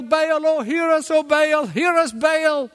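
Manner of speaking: a hammed-up wail to an idol; begging prophets of Baal. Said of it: "begging Baal".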